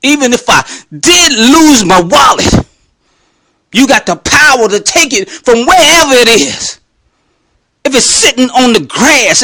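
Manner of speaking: emphatic, preached-up prayer; forceful faith declaration. Retold or imitated. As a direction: declare it with rising conviction to God. Same prayer repeated as preached declaration.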